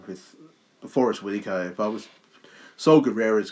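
usual speaking voice

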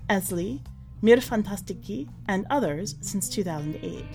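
usual speaking voice

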